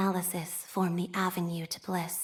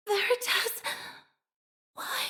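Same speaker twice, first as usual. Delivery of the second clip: much higher cry, fading to a breath; anguished; 11 semitones higher than usual. Acted. Fallen operator crying out to Veritas, anguished. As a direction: cry out to your god in defeat. Falling in battle.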